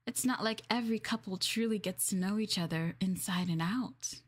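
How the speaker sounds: normal talking voice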